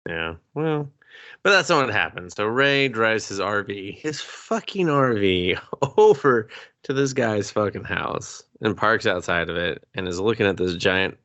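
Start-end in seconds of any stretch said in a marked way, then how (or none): none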